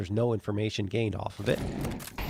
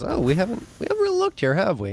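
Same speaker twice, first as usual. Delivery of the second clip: mock realization; 8 semitones higher, faster than usual. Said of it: "Academy realizes".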